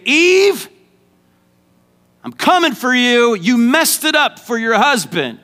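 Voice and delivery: high, loud mock threat; God threatening Eve. God threatens Eve who messed up, loud and high.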